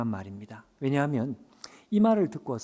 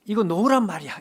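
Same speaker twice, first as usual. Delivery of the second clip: mock angry yank-away; 7 semitones higher, 7 dB louder than usual. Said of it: "막 뿌리치면서요".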